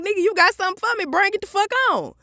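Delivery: high, fast mock challenge; high fast dare; defiant retort. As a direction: fire it back high and quick.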